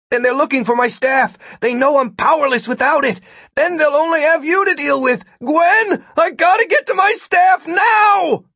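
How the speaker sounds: high voice climbing to a shout; frantic, rising demand; panicked urgency. A narrator's reading. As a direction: build from alarm to desperate yelling. Martin panics over his staff.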